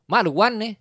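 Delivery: a light southern Thai auntie voice; local southern Thai twang. Retold or imitated. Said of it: "สำเนียงเรามันเหน่อแล้วอะ".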